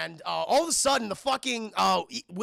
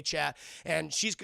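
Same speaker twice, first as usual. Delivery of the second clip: ordinary talk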